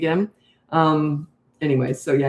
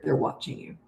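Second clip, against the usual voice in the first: soft, breathy whisper that drives the point home; whispered emphasis; 3 semitones lower, 9 dB quieter than usual. Real, not acted. Telling parents that young kids learn by watching, in a whisper. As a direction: drop to a hush so they lean in.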